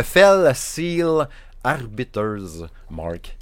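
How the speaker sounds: "Fell Seal Arbiter's Mark" spelled out slowly, word by word; careful slow pronunciation. Real, not acted. Gamer struggling with an English title in his Saguenay accent, slow careful pronunciation. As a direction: take your time over "Fell Seal Arbiter's Mark". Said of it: "J'ai toujours beaucoup de misère à prononcer ce jeu adéquatement".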